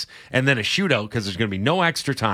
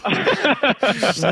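high burst of laughter; high, amused laugh; 9 semitones higher than usual. Real, not acted. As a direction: crack up at the bickering. Laughs at dysfunctional relationship remark.

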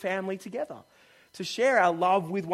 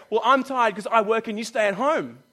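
mock defensive retort; 4 semitones higher, 8 dB louder than usual. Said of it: "Then I go".

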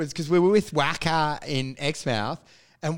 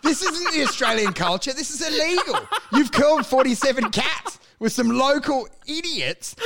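high, incredulous scolding; mock outrage; 7 semitones higher than usual. Scolding Wacker for hunting cats, mock outrage. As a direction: protest in comic outrage.

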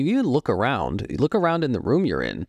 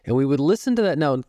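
usual speaking voice

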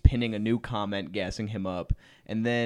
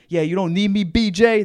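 mock angry shout; 9 semitones higher, 10 dB louder than usual. Boyfriend storming out.

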